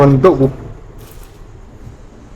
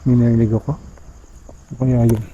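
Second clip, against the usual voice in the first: hushed and uneasy; 3 semitones lower, 5 dB quieter than usual. Real, not acted. Heard a noise, spooked.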